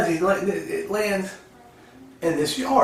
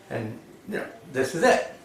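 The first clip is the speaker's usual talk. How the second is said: ordinary talk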